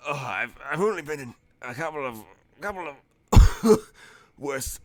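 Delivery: put-on battered old man forcing out words between coughs; hurt and coughing. Bruised adventurer to the party, coughing through it. Battered NPC rasps after drowning.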